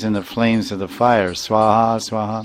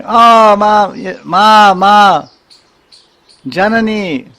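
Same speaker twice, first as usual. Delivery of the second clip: high, loud pleading cry of Ma, much higher and louder; crying out for mother; 9 semitones higher, 7 dB louder, slower than usual. Retold or imitated. Devotee hearing the cows cries out to mother, high pleading wail. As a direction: wail for your mother like a child. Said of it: "Then you start crying".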